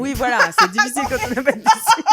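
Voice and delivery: laughing through the words; giggling while talking. Real, not acted. Laughing repeat of a joke.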